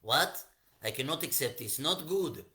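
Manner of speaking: loud, indignant king with put-on accent; hammed-up accented indignation, rising in pitch; angry Shaul. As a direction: refuse it in acted outrage. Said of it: "Shaul was so angry".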